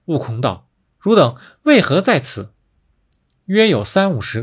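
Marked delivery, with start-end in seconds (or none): 1.0-2.5 high, loud, startled question; urgent questioning. A narrator's reading. Wukong finds captured little monkeys, loud surprised question.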